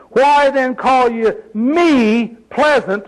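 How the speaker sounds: slow reading with "me" drawn out; stress on me. Shown as a demonstration. Preacher showing up Naomi's complaint, heavy emphasis on me. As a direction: stretch "me" and set it apart. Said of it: "put emphasis on the words as I read it".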